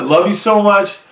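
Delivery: high, loud, beaming love you; bright gushing farewell; gushing sign-off. Real, not acted. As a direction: gush it out to the fans. Vlog sign-off to viewers.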